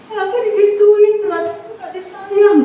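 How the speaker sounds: high, slowed mock-wounded voice; hurt woman imitation. Preacher imitates women who cry easily, mock-hurt whine. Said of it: "Wanita sedikit saja".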